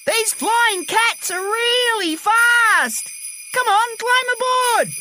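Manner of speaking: very high, drawn-out excited call. A narrator's reading. Jill calls from her flying cat sleigh, high excited shout. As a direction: show it off, calling across the sky.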